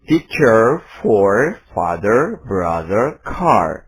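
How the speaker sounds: American accent, R sounded at word ends; American English. Shown as a demonstration. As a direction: say teacher, father, car the American way with the R. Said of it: "Saya akan mengucapkan".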